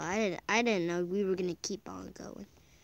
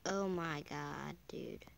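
stunned hush; 6 semitones lower, 10 dB quieter, slower than usual. Real, not acted. Stunned by game.

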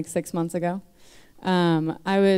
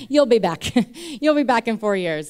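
higher, louder, chuckling aside; amused, knowing prediction; 5 semitones higher, 5 dB louder than usual. Retold or imitated. God teasing her as she leaves church, amused. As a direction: chuckle through a teasing forecast.

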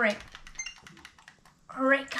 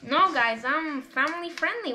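ordinary talk

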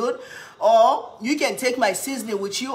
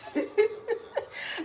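breathy laugh; 12 semitones higher, 6 dB quieter than usual. Real, not acted. Laughs after the goal.

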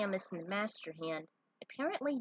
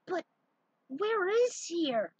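bewildered friend; 10 semitones higher, 4 dB louder, much slower than usual. Acted. Friend confused at track.

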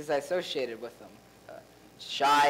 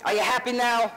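irritated, louder snap; annoyed; 7 semitones higher, 4 dB louder than usual. Real, not acted. Exposed actor snaps at the cast after confessing. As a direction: throw it back at them.

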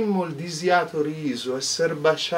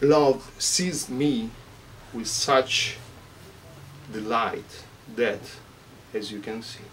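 poetry reading; 3 semitones lower, 5 dB quieter than usual. Acted. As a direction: read Francesca's lament like a poem. Reads Dante aloud.

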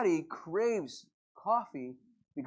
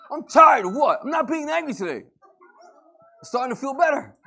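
much higher, louder hammed-up indignation, slowing at the end; mock angry rant; 9 semitones higher, 12 dB louder than usual. Pastor mocks an anger-addicted person with a hammed-up indignant rant. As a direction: rant like someone who needs anger.

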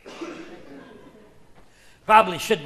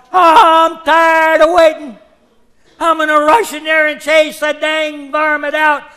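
put-on shouted old-timer quote; 5 semitones higher, 8 dB louder than usual. Pinto Jack yells out.

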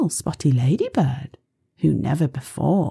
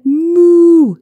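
loud moo; 14 semitones higher, 11 dB louder than usual. Pretend. Cow sound imitated.

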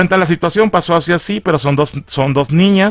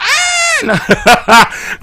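risa aguda. Real, not acted. Recuerda el grito en el cine.

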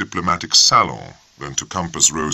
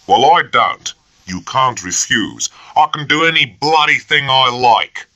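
louder and higher, angry outburst; first lieutenant snapping at a junior officer, angry and louder; flaring up; 9 semitones higher, 5 dB louder than usual. A narrator's reading. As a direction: flare up at him. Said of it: "Bennett flared up".

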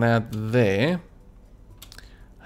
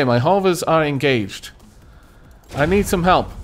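usual speaking voice

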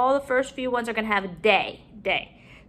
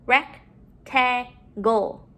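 "Rectangle" slowly, in separate chunks; syllable by syllable; much slower than usual. Shown as a demonstration. Teacher modeling syllables to put together, broken up. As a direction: split "Rectangle" with a pause after each syllable. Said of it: "let's put our syllables together".

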